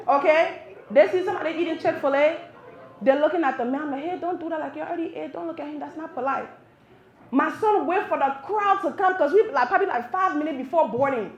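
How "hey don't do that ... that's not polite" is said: soft, raised-pitch reprimand; hushed scolding. Retold or imitated. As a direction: correct a child under your breath in public. Mother hushing child in public.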